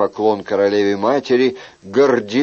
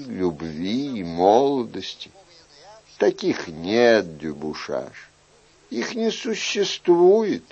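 slow, softer, coaxing royal voice; paternal king; 3 dB quieter than usual. Acted. King urges young man to try winning love, paternal. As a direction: coax the youth with lingering, swooping phrases.

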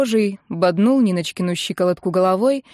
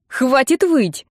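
higher, slow, drawn-out command; exasperated order; 5 semitones higher, much slower than usual. A narrator's reading. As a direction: put a stop to the wailing. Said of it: "не выдержала Нина и топнула". (раздражённо требует)

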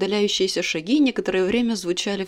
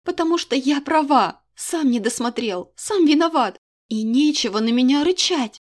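higher, louder triumphant blame; furious scolding; 5 semitones higher, 4 dB louder than usual. Acted. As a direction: pile it on him. Heroine scolds the king.

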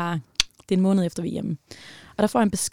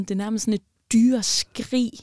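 ordinary talk, nothing marked

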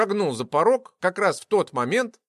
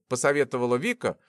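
plain reading voice

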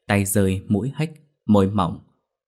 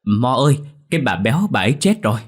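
raised, higher deferential announcement; eager-to-appease servant, pitched up and loud; placating; 5 semitones higher, 5 dB louder than usual. A narrator's reading. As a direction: call up politely, holding back annoyance. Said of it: "cố dịu giọng mà lên tiếng".

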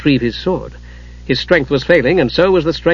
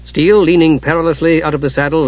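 ordinary delivery

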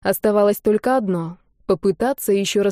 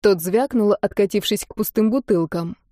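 plain reading voice

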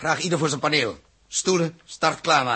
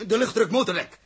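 higher, urgent, rapid orders; urgent alarm; 6 semitones higher, 4 dB louder than usual. Acted. The captain barks orders to his crew in alarm. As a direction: snap out the orders as the ship is hit. Alarm after blow to ship.

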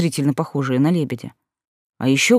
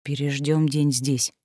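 soft, slow near-whisper; barely audible; 3 semitones lower, 6 dB quieter, slower than usual. A narrator's reading. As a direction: say it barely above a breath. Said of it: "произнёс он еле слышно".

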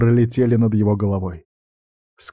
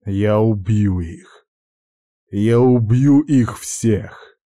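vengeful vow; much slower than usual. A narrator's reading. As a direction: swear revenge slowly, building.

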